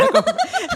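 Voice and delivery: sudden high burst of laughter. Real, not acted. Hearing the gringo joke, a high laugh. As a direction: cracks up.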